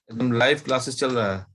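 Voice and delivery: ordinary talk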